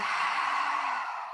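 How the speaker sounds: long unvoiced breath out; audible sighing breath out; exhale sigh. Shown as a demonstration. Demonstrates exhale in meditation.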